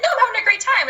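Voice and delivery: breezy put-on enthusiasm; imitated upbeat reassurance. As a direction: play the keen friend. Voicing friend's upbeat answer.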